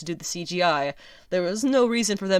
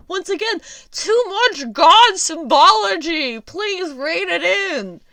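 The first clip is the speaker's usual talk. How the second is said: exasperated plea; 11 semitones higher, 6 dB louder, slower than usual. Real, not acted. Pleading, exasperated complaint.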